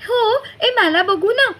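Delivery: put-on high, loud girl's cry; eager shout. A narrator's reading. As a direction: call out eagerly to see. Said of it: "निकिताताई ओरडली".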